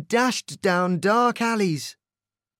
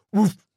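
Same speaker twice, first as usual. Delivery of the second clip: woof; 4 semitones higher, 6 dB louder than usual. A narrator's reading. Dog bark in story.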